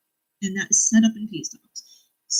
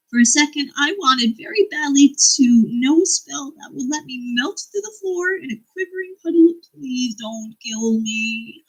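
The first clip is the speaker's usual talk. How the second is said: higher, louder quote reading ending in a slow plea; reading a book aloud; 5 semitones higher, 4 dB louder than usual. Acted. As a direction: read the passage up, then drag out the plea. Reads another book quote.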